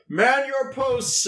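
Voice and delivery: slow, high rallying shout; loud shout. Acted. Soldier rallying cowering comrades on the wall, shouting. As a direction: drag out the order like a commander. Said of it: "I'm gonna shout really loudly".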